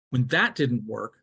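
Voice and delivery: ordinary talk, nothing marked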